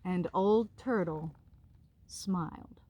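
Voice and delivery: gentle storybook narration; reading to children. A narrator's reading. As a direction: read it out like a bedtime story. Continues reading the book.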